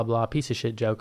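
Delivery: ordinary delivery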